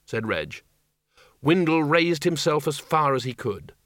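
ordinary delivery

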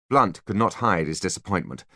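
ordinary delivery

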